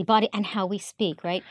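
ordinary delivery

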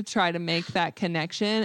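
usual speaking voice

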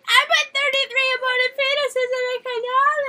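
high, loud put-on ranting voice; mock conspiracy rant. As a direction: rant like a wild-eyed conspiracy nut.

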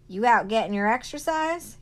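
hollering. Acted. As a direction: holler from the Jeep. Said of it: "he hollered".

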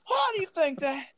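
squeaky put-on voice; high silly voice.

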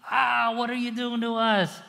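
hammed-up grumbling crowd; complaining Israelites. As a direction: moan at the leader. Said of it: "Again, complaining".

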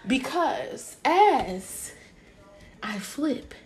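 slow, halting mock-author reading; B Simone book voice. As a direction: measure it out word by word, with pauses. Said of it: "Not, not in her B Simone book".

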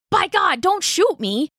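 high, frantic plea; pleading. Acted. Rider begs the colonel not to shoot, high and frantic. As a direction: beg them not to fire.

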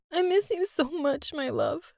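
high tearful voice, slowing down; crying. A narrator's reading. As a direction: miss him through tears. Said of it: "She was looking at a picture and crying".

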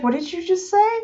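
high, puzzled question; mock bafflement. Viewer replays reaction to hearing French, baffled. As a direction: act lost, voice lifted high.

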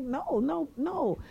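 high-pitched repeated refusal; retold upset. Woman still upset, refusing his explanation, retold protest. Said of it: "So I was upset still".

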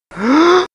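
loud, high acted gasp; shocked gasp. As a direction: gasp sharply in mock horror. Owner shocked at dead dog.

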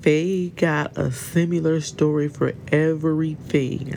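usual speaking voice

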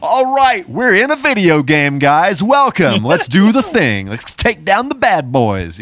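high, loud hammed-up hype voice; cheesy game announcer. Mocking the Doom movie's shift into game mode, hammed-up hype. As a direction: play the corny video game host welcoming players. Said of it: "goes from this movie all of a sudden".

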